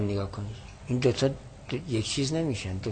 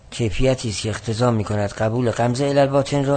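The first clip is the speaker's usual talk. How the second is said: ordinary talk, nothing marked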